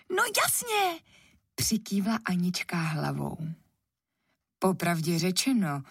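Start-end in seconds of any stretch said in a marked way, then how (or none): 0.1-1.0 put-on high-pitched eager little girl; eager agreement. A narrator's reading. Anička agrees to her mother's condition, high and eager.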